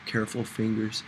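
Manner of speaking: usual speaking voice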